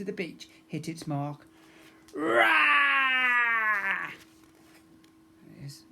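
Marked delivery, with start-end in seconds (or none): 2.1-4.1 high, loud roaring shout; pirate battle roar. A narrator's reading. Pirate crew bursting through the wall, loud roar.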